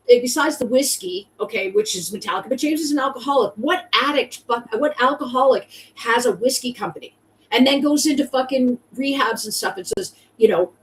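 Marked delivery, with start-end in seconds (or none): none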